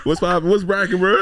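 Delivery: loud joking West Coast street voice; gangbanger voice. Acted. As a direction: ham up a gangbanger greeting while laughing. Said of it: "like, gangbanger voice".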